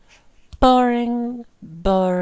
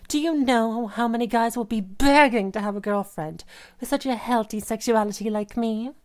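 ordinary talk, nothing marked

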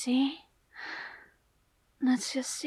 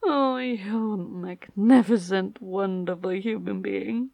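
adoring; 7 semitones lower, slower than usual. Acted. Slow adoring praise after climax.

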